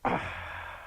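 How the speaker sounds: long hissing angry exhale; voiceless snake hiss of anger; angry breath. Shown as a demonstration. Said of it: "angry breaths".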